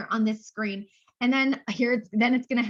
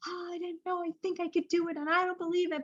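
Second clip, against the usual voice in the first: a hint of a shy nervous woman, much softer and higher; timid doubter; 6 semitones higher, 7 dB quieter, faster than usual. Retelling shy directors before Mary Kay, a soft timid mimic. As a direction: mimic a timid new recruit doubting herself. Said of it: "they were like".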